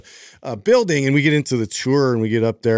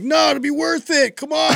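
high-pitched, pleading persuasion; coaxing sales pitch. Retold or imitated. Retelling how he coaxed his girlfriend out of the car, overeager. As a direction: sell it hard, overeager and wheedling. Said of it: "I'm, like, trying to sell it".

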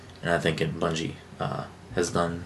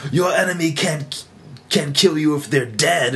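Lord Shaxx voice; 8 semitones higher, 3 dB louder than usual. Retold or imitated. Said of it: "and he's like".

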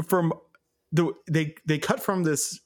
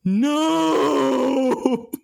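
much higher, louder overwrought cry; dramatic no; 12 semitones higher, 6 dB louder than usual. Retold or imitated. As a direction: wail it like a hammy villain on his knees. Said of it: "drops to his knees and then goes".

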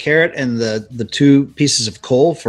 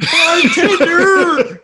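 high, loud, very slow imitated yell; drawn-out mock shout; 5 dB louder, much slower than usual. Co-host echoing the bar scene, in a long mock shout. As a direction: stretch the call out, breaking into laughter.

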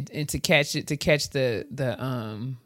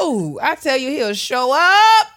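preacher voice; 8 semitones higher, 7 dB louder than usual. Acted. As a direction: preach it like Sunday service. Preaching about the Lord.

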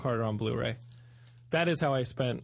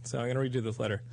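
ordinary talk

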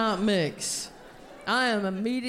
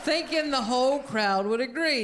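ordinary talk

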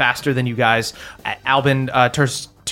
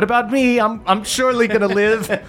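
lightly voiced cultist, higher and anxious; a hint of a panicked minion hoping to be spared; nervous cultist; 7 semitones higher than usual. The forgotten cultist pipes up, lightly voiced and worried. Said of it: "the other cultist goes".

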